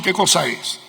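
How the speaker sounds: ordinary delivery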